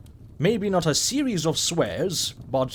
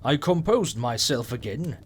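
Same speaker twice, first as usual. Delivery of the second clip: ordinary delivery, nothing marked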